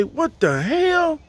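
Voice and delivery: high, slow stunned what the hell; shocked disbelief. Retold or imitated. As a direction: drag it out in disbelief. Power still out after new fuses.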